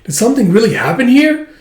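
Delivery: high, loud, laughing panic; freaking out, half laughing; alarmed outburst. Retold or imitated. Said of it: "He's like".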